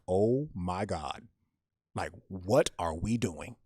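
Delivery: drawn-out disbelieving groan of words; retold dread, stretched out; slow dismay. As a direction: say it slowly, at a loss. Retold dismayed inner thought.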